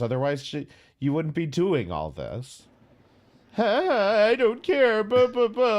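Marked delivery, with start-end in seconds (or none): 3.5-5.8 high, loud mocking voice. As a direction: sneer it like a whiny brat. Mocking someone claiming not to care.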